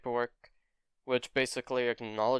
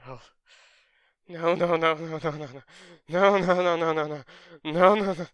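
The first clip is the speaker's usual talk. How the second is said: grieving denial; 4 semitones higher, 4 dB louder than usual. Acted. Izuku learns mother is dead.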